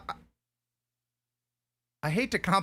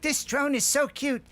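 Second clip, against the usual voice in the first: put-on excited old customer; 8 semitones higher than usual. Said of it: "they're like".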